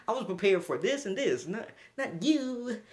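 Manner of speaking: ordinary talk, nothing marked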